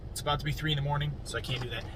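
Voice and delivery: quiet so the wife can sleep; hushed. Real, not acted. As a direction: drop the voice, fast and hushed. Wife sleeping, late night.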